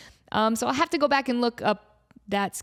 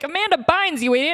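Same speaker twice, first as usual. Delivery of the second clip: teasing shout; 7 semitones higher, 5 dB louder than usual. Retold or imitated. Writers mock-scolding starstruck kid.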